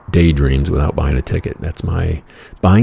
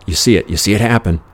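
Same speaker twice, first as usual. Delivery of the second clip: ordinary delivery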